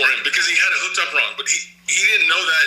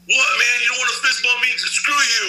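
imitating an offended guy telling him off; angry retort; 6 semitones higher than usual. Angry guy refused fist bump.

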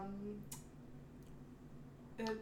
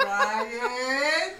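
name drawn out louder, rising through laughter; laughing outburst; 11 dB louder than usual. Retold or imitated. Co-host retells reacting to the acronym, laughing outburst.

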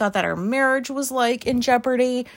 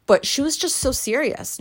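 ordinary talk

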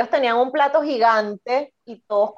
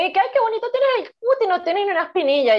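much higher, gushing compliment; gushing girl voice; 8 semitones higher than usual. Retold or imitated. Girls complimenting her skin, high and gushing. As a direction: coo it like an admiring girl.

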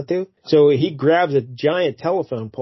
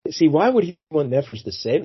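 ordinary talk, nothing marked

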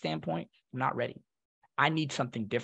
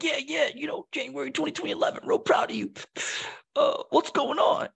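imitated uncle, pitched well up; high offhand praise, slowing into the closing question; smoking uncle; 12 semitones higher than usual. Smoking uncle half-listens to his nephew's news, casual impression. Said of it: "he's got a cigarette and he's just".

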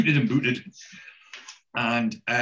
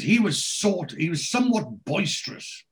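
prissy landlord; 7 semitones higher than usual. Retold or imitated. Arthur's complaint, acted.

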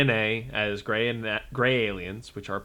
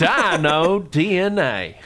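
slow, high cartoon announcer; Mister DNA voice; 5 semitones higher, 6 dB louder, much slower than usual. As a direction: stretch it like the cartoon mascot. Quoting Jurassic Park cartoon.

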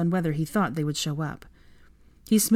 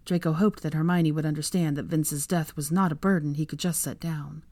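usual speaking voice

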